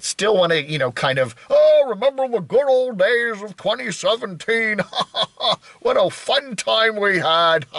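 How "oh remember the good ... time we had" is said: mock nostalgia. Old community member recalling good old days, mock nostalgia. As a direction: ham up misty-eyed old-timer reminiscing, with chuckles.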